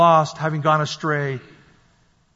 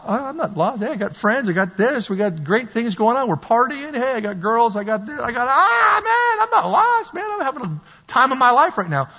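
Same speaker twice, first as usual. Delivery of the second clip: higher-pitched braggy denial climbing to a wail; mock indignant, voice shooting way up; defensive partying friend; 6 semitones higher than usual. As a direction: protest like a carefree party friend. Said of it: "If you talk to your friends".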